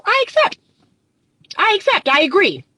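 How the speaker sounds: high, breezy I accept; a hint of a mock pushover; mock acceptance. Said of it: "That's what you're saying".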